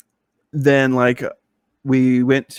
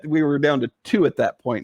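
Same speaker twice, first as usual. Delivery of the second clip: ordinary delivery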